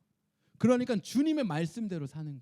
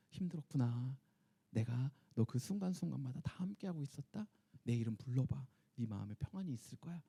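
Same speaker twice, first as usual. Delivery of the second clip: lower, softer, tender reassurance; comforting God; 5 semitones lower, 7 dB quieter than usual. Retold or imitated. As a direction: soothe a tired child to sleep. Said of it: "하나님이 오셔서". (지친 성도를 위로하는 하나님, 낮고 부드럽게)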